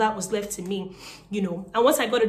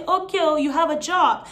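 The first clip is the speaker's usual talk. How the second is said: mock cheerful caller; 5 semitones higher, 5 dB louder than usual. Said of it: "Nobody was calling my number to say".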